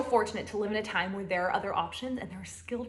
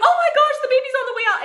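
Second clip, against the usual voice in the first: much higher, louder mock-panicked nurse; 8 dB louder, faster than usual. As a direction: squeal it like a nurse losing her cool.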